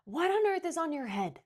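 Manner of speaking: loud, high, bossy shout; shrill indignant busybody yelling. A narrator's reading. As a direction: bellow it at her across the street. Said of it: "She always shouted everything".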